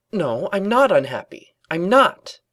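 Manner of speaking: high, loud, forced insistence; too-loud denial. A narrator's reading. Twilight denies unhappiness in case anyone listens, too loud. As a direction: protest it too loudly. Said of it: "Twilight said this a bit too loudly".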